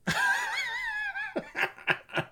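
high-pitched burst of laughter. Real, not acted. Cohost laughing at his own joke, high laugh. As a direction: cackle at the joke.

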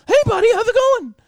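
bright high chirpy hello to a neighbor; cheery friendly greeting. Retold or imitated. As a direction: greet him like a happy-go-lucky goof. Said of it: "your fucking happy-go-lucky, goofy self".

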